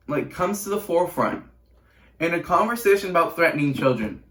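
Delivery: loud, high-pitched disbelief; incredulous outrage. Real, not acted. Teen asks listeners how owning a house matters, incredulous.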